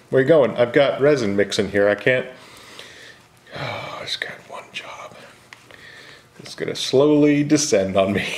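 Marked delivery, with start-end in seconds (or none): 3.5-6.7 whispering. Real, not acted. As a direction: breathe it out under your breath. Muttering to the camera.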